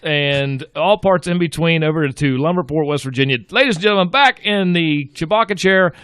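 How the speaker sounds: loud, high showman announcer voice; ring announcer intro. Acted. Host hyping the Japanese liaison to the audience, loud, high announcer call. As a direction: boom it out like introducing a prizefighter.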